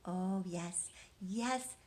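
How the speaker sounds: normal talking voice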